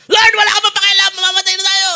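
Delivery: high, loud desperate cry to the Lord; panicked shout. Acted. Peter shouts at Jesus in the storm, panicked. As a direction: shout it like a disciple sure he'll drown. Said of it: "si Pedro lang malakas ang loob".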